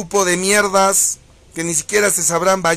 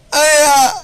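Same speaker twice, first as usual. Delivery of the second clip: high, loud, hammed-up crying shrieks; over-the-top fake sobbing squeals; mock frightened wailing; 9 semitones higher, 8 dB louder than usual. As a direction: wail like a scared crybaby to mock them. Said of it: "Eso deben gritar".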